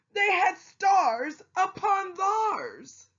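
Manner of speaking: high, slow, shouted with glee; excited shout. A narrator's reading. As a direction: cry it out in triumph, drawn out. Excited climax of story line.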